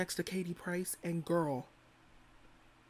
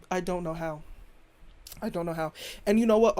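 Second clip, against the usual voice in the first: usual speaking voice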